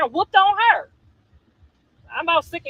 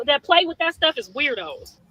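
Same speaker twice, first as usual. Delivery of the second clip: ordinary delivery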